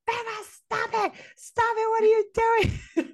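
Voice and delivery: worried partner screaming through the phone; imitating John Liam yelling. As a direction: squeal at her to stop over the phone. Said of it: "John Liam is screaming at me".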